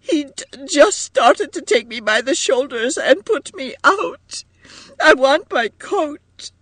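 very high, distraught wail; tearful wailing. A narrator's reading. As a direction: wail the complaint through tears. Wailing Edith goes on complaining.

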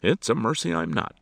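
high, loud retort; sharp retort. A narrator's reading. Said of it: "Alexander retorted".

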